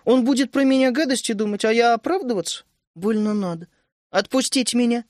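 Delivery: put-on girl refusing to make up; sulky. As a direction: whine to be let go. Vishnya sulking.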